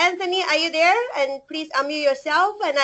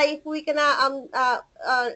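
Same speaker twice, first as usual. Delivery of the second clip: usual speaking voice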